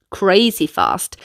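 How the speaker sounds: ordinary delivery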